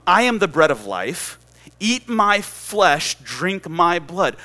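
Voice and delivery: loud, very slow, weighty declaration; emphatic preaching proclamation. Retold or imitated. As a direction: proclaim each phrase with slow force. Preacher voices Jesus's solemn declaration.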